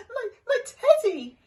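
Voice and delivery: high, loud sniffling cry. Acted. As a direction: sob about the lost teddy. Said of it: "he sniffled".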